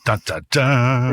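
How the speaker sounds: slow dramatic dun dun dun; hammed-up mystery fanfare; mock drum roll. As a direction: sing out a mock suspense sting.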